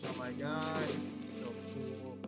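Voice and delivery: ordinary talk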